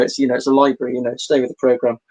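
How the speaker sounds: normal talking voice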